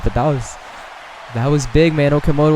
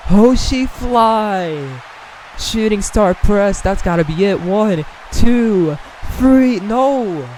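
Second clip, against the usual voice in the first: high, loud, stretched-out hype call; excited; 7 semitones higher, 3 dB louder, much slower than usual. Real, not acted. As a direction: call the finisher at full hype. Finisher and near fall.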